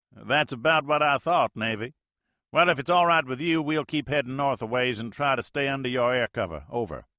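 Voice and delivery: folksy Mississippi skipper talk, loud; Mississippi accent. Acted. Major answers the Navy plane in Mississippi accent, loud and folksy. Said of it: "The major's Mississippi accent".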